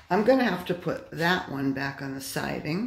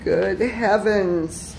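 louder, slow exasperated cry; exasperated exclaim; 2 semitones higher, 7 dB louder, much slower than usual. Real, not acted. Man repeating his dismay at the derail, louder. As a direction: say it out loud in disbelief.

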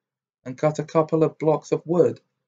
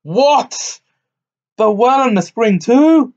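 loud, high, alarmed giant; a startled giant grumbling in his beard; a giant alarmed by the tailor's boast; growled; 9 semitones higher, 6 dB louder than usual. A narrator's reading. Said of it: "growled the giant in his beard".

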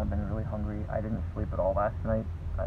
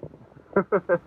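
bright burst of laughter; nervous excited laugh; 10 semitones higher, 11 dB louder than usual. Real, not acted. Nervous excited laugh before surgery.

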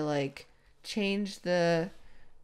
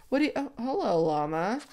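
normal talking voice

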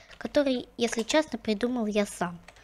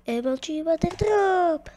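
slow, loud epic trick name; hype announcement; 6 semitones higher, 5 dB louder, slower than usual. Acted. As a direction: announce it like a big move.

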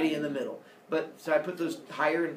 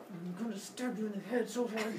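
mock seething; 3 semitones higher, 8 dB quieter than usual. Jealous goddesses seething while staring.

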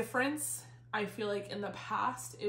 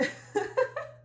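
high breathy laugh; 3 dB louder than usual. Real, not acted. Laughs at feeling fat.

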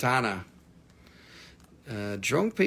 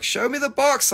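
mock customer; 12 semitones higher, 4 dB louder than usual. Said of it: "She was like".

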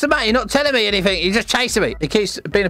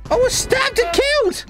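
mock outrage. Stabbed in game, outraged.

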